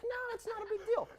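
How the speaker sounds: squeaky high, no big deal; high insisting. Retold or imitated. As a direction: brush it off in a high voice. Insisting spider is harmless.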